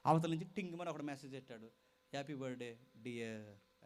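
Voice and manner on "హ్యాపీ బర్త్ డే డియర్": put-on sweet-talking boyfriend. Boyfriend's message voiced.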